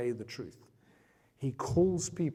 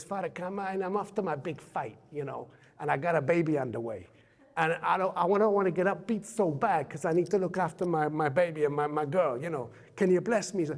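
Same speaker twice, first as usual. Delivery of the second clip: higher, lightly voiced Rocky asking a favour; Rocky pleading; 4 semitones higher, 3 dB louder than usual. Rocky asks the priest for a blessing, mock pleading. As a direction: beg like a lightly imitated Rocky. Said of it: "Rocky says".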